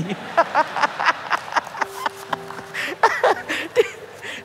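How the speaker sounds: a long, high, airless laugh; breathy high laugh. Real, not acted. Preacher laughing at his own joke about the girl, high.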